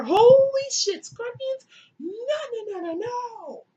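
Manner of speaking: high, hammed-up alarm, loud at first; comic shriek into high refusals; mock horror. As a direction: recoil in exaggerated high-voiced panic. Rich woman recoils from scorpions.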